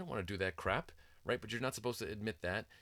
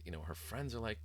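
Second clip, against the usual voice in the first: normal talking voice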